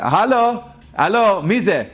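lightly acted Israeli man calling out; calling out hello. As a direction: call out to see who is there. Young man calls down hallway.

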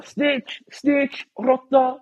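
high, thin mock jeering; imitated online haters. Everyone online reacting to his photo with the rapper, high mocking jeers. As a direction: mimic everyone calling you a rat. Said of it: "Alla bara".

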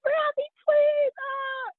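high, squeaky mock wail of joy; imitated happy crying. Mother hearing of twins by phone, imitated joyful crying. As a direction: wail it like an overjoyed mom in tears. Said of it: "she started crying on the phone".

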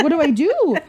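high-pitched comic wail; mock helpless panic. Daughter at a loss as dad rushes over, mock panic. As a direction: exaggerate being at a total loss.

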